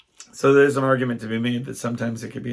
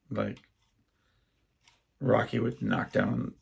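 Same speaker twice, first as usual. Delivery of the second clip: ordinary delivery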